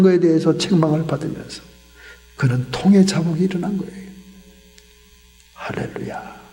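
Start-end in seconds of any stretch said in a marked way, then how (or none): 5.5-6.4 an awed, voiceless hallelujah; hushed praise under the breath; whispered hallelujah. Real, not acted. Exclaims praise after the healing story.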